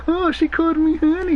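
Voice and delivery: hammed-up starstruck gushing; mock swooning fan. Fan mocking his own swoon over being called honey. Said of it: "I didn't do this".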